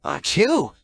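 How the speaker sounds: a big sudden sneeze. Acted. Hero has a cold.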